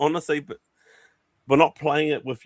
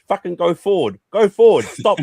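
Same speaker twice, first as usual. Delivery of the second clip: frustrated shout, much louder; yelling at the voice thing; 6 semitones higher, 7 dB louder, slower than usual. Retold or imitated. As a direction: yell commands at the game. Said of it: "Like, I said".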